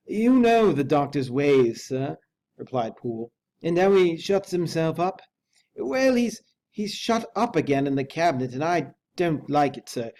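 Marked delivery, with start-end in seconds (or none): 0.1-2.2 slow, loud, troubled servant voice; loud, slow and uneasy manservant; frightened butler. A narrator's reading. Poole's anxious voice in story. 3.6-10.1 frightened butler. A narrator's reading. Poole's anxious voice in story.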